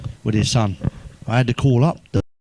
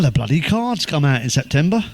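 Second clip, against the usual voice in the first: ordinary talk, nothing marked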